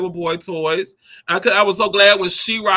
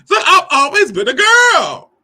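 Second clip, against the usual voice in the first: louder, higher, slow and proud; emphatic declaration; 10 semitones higher, 6 dB louder, slower than usual. Real, not acted. Narrator sums up childhood to viewers, proud emphatic slow. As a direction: proclaim it with full chest. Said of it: "declaring identity".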